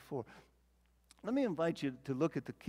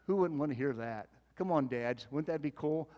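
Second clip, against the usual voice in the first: normal talking voice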